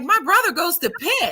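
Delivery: high-pitched, eager announcement; excited quote. Retold or imitated. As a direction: imitate an excited stranger sharing news. Retelling the stranger's excited news.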